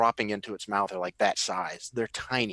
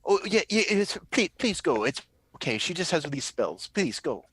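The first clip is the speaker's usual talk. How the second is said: flustered reassurance; 7 semitones higher than usual. Acted. Game master as Noella urging visitors to go, flustered pleading. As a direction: politely urge the visitors to leave.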